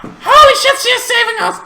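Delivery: very high, loud joyful squeal; high-pitched thrilled cheer; excited shriek. Retold or imitated. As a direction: squeal with relief. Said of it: "I'm like".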